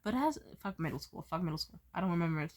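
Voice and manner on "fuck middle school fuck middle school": usual speaking voice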